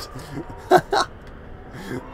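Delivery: unvoiced wheezing laugh; breathy silent laugh. Real, not acted. Gamer wheezing with laughter at the corpse.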